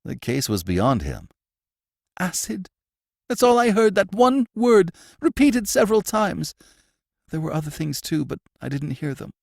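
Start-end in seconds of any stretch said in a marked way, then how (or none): 2.2-9.3 a scared man insisting on what he heard; frightened prisoner telling the warden what he heard, high and shaken. A narrator's reading.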